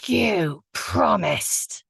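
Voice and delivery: drawn-out, high, loud accusing shriek. A narrator's reading. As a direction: shriek it as the betrayed ghost, stretching each word. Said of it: "and shrieking".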